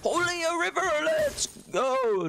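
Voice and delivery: very high, hyped-up impression; Grady. Streamer imitating a player on getting river, high excited impression. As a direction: squeal it out in a put-on hype voice. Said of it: "that was a great Grady impression".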